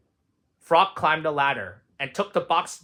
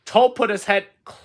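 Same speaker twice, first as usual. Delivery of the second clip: usual speaking voice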